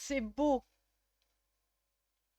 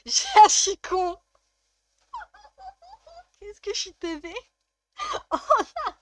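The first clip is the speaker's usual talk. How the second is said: high voice breaking up with laughter; squeaky giggles swallowing the words; helpless laughing; 11 semitones higher, 7 dB louder than usual. Real, not acted. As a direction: laugh at your own stupidity while talking. Cracking up at her own mistake.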